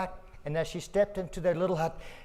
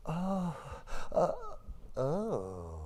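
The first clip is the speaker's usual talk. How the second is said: ordinary delivery, nothing marked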